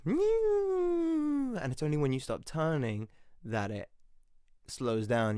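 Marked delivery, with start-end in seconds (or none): 0.0-1.6 very high squeaky meow; silly meow. Retold or imitated. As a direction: make the wheel squeak like a cat. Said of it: "it goes".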